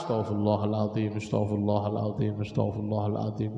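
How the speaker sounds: low, even recitation of istighfar; murmured dhikr. Shown as a demonstration. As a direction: repeat it evenly on a low note. Demonstrates continuous istighfar.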